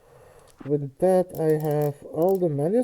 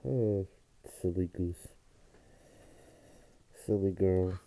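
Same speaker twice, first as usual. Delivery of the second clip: cooing softly at a pet; 8 semitones lower, 7 dB quieter than usual. Real, not acted. Cooing at the dog.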